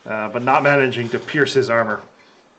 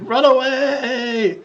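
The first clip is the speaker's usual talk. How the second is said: high, long drawn-out comic wail; mock fleeing cry; 12 semitones higher, much slower than usual. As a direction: stretch it out while fleeing in panic. Game master voices creature fleeing.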